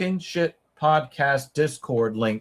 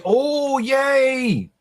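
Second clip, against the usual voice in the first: excited; 10 semitones higher than usual. Real, not acted. Delighted the fight is on.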